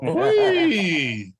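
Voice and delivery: high, loud "Whee!"; gleeful cheer; excited whoop. Real, not acted. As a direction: whoop it up with glee. Excited reaction to joke.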